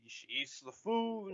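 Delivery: normal talking voice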